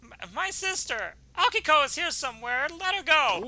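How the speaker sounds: put-on high, urgent child voice; high-pitched kid pleading for his sister; desperate little boy. Boy demands sister be released.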